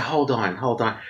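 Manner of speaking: low, soft hush; hushed urging at the door. Retold or imitated. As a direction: keep it down, hold on. Lover hushes her at the door.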